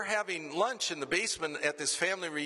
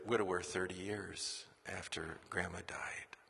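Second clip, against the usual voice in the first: hushed whisper; 6 semitones lower, 7 dB quieter than usual. Real, not acted. Tender story ending.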